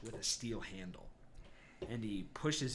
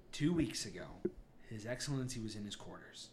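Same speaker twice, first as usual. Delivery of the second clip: grave Garrick account; 3 semitones lower, 7 dB quieter than usual. Acted. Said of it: "he looks at you all and goes".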